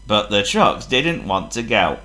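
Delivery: ordinary delivery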